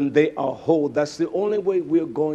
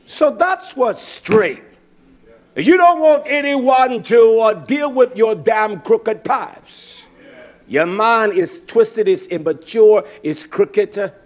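loud, high fiery preaching; fiery sermon delivery; 6 semitones higher, 8 dB louder than usual. Acted. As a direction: preach it hard to the congregation. Preaching at full force.

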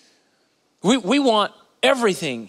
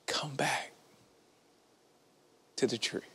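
hushed invitation; 12 semitones lower, 16 dB quieter than usual. Acted. Pastor softly invites listeners back to prayer, hushed. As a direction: call them back gently and softly.

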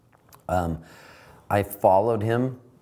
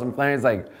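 usual speaking voice

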